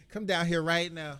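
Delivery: loud stern command; yelling. Retold or imitated. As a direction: call the kid down, loud. Parent calling kid.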